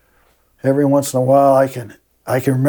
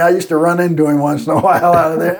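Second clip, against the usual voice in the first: ordinary delivery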